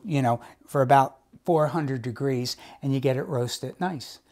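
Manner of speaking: ordinary talk, nothing marked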